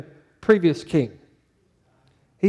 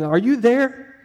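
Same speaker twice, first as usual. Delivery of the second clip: pitched up, mock-worried question into a dead line; anxious call into phone; 6 semitones higher, slower than usual. Caller on a dropped phone call, higher mock-anxious.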